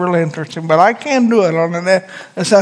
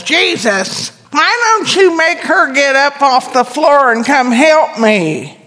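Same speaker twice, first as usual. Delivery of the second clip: high, whining complaint; whiny voice; 7 semitones higher, slower than usual. Retold or imitated. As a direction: whine it like a put-upon sister. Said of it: "in her best whiny voice".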